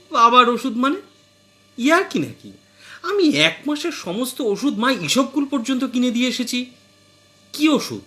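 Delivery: a wife who can't believe her ears; astonished wife. Acted. Wife questions husband about her father's medicine, astonished and high. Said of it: "বউ অবাক হয়ে বলল".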